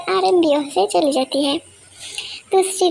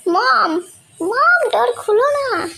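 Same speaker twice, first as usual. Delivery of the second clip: put-on childish calling; 6 semitones higher, slower than usual.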